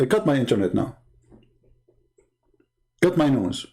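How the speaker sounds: ordinary delivery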